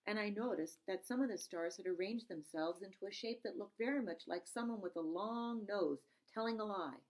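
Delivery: plain reading voice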